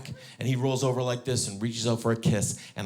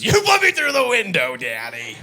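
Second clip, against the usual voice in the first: put-on much higher, louder little girl; daughter accusing; 8 semitones higher, 7 dB louder than usual. Daughter put through the window, high loud accusation. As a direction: say it like a tattling kid. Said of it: "she goes".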